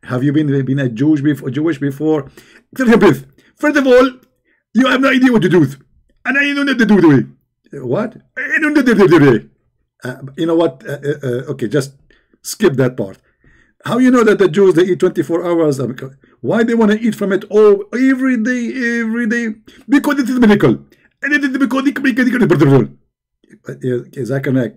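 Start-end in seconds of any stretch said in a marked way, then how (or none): 2.8-9.5 Zakir Naik. Retold or imitated. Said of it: "If we ask Zakir Naik". 13.9-22.9 Zakir Naik. Retold or imitated. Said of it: "If we ask Zakir Naik".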